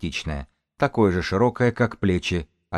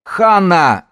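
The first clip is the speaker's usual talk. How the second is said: high, loud cry of the name; yell; 11 semitones higher, 10 dB louder than usual. A narrator's reading. Man yells to his leader, high loud holler. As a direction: holler in alarm. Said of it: "заорал Гонсалес".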